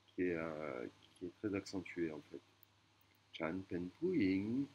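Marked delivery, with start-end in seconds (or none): 3.3-4.7 "Chan pen puing" spoken very slowly, rising on "puing"; slow Thai sentence. Shown as a demonstration. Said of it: "je suis une femme".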